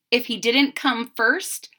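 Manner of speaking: plain reading voice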